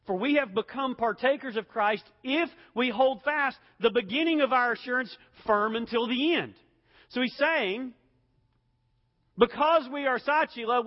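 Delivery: loud, high, driving sermon voice; preaching at full force. Acted. Pastor urging his congregation, loud preaching. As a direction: hammer the point home to the pews.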